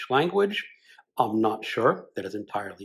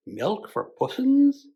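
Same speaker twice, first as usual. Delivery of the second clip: put-on much higher, slow baby talk; cooing to the cat; 12 semitones higher, slower than usual. Bloom bends down offering the cat milk, high cooing. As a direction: coo it like talking to a pet. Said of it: "he said".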